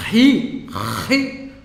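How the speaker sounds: ri with a harsh throat sound, the ch scraped from the back; guttural ri. Shown as a demonstration. Teacher demonstrating the Greek letter: rasping guttural ri. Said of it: "esse som meio de como se você tivesse meio ruim da garganta".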